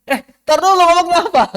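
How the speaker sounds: high, loud baffled question; mock puzzled listener. Teacher playing a confused listener demanding the rest.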